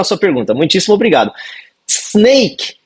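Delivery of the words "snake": "Snake" with no vowel before the s; pronunciation demo. Shown as a demonstration. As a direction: start "Snake" straight on the s.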